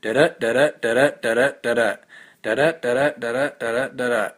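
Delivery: "da-da" chanted unstressed then stressed; singsong meter demo, each "da-da" weak-strong; iambic rhythm. Shown as a demonstration. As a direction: tap out "da-da" with the second syllable stronger. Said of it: "it's iambic meter".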